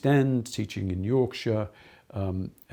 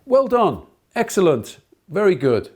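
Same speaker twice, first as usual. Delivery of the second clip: much higher and louder, bright praise; enthusiastic praise; 9 semitones higher, 11 dB louder than usual. Shown as a demonstration. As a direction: cheer a pupil who did well. Demo of praising children.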